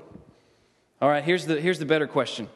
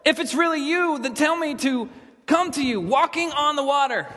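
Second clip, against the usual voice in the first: pleading Peter; 10 semitones higher than usual. Acted. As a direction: call out to the Lord across the water, raised and high. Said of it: "Then Peter called to him".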